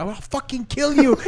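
high, loud mock-furious threat; hammed-up murderous shout; mock yell. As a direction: scream it like you're chasing him. Quoting chasing girl.

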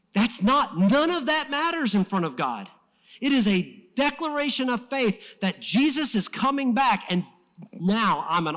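loud, high-pitched declaration of faith; impassioned preaching. Real, not acted. Preacher proclaiming Jesus to the congregation, impassioned. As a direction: preach it at full force.

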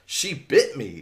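outraged yell. A narrator's reading. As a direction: protest in mild outrage. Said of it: "David yelled, outraged".

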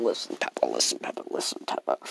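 whispered plea to Peppa; whispering. Acted. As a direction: hush it to Peppa, no voice. Repeating to get attention.

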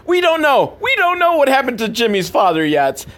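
high, hammed-up suspense; mock dramatic. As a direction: play up the mystery like a trailer.

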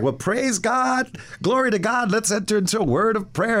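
mock a joyful preacher drawing out praise; mentor imitated. Mentor in the diner calling him to prayer, hammed-up preacher voice. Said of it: "this guy was like".

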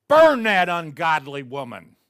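loud, harsh command to burn her; condemning shout. Retold or imitated. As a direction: bellow it like a judge passing sentence. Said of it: "And he goes".

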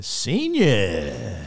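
"senior" stretched very long; drawn-out catchphrase. Shown as a demonstration. Dean showing his after-chapel word, drawn-out catchphrase. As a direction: draw out the word "senior" as a slow call. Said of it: "word that you use after every single chapel".

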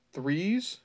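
"threes" said with a th sound; "threes" with th, not t; misread word. Shown as a demonstration. Reader stressing the author's typo "threes", puzzled. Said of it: "I think he meant to say trees".

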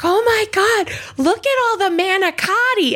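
very high, gushing squeal; excited tourist. Retold or imitated. Assistant gushing at her first Italian deli, high squeal. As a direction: squeal it like a wide-eyed tourist. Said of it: "she's like".

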